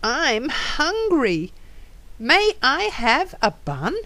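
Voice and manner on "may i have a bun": usual speaking voice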